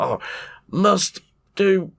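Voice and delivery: forced happy Ren. Retold or imitated. Friend retells Ren in the happy helmet, high strained voice. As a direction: squeeze it out high like he's fighting it.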